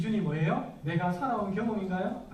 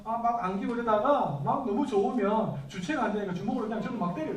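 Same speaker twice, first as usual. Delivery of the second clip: normal talking voice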